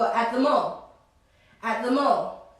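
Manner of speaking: "At the mall" run together as "atthemall", the words blending; linked pronunciation. Shown as a demonstration. Teacher shows students how "At the mall" connects in real speech, linked. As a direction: glide "At the mall" into one smooth word. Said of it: "En vez de decir at the mall".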